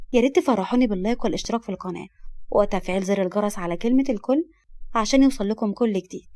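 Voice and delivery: ordinary delivery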